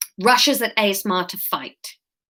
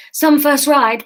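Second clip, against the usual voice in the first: chattering teeth; 5 semitones higher, 5 dB louder than usual. Acted. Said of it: "trying a joke through chattering teeth".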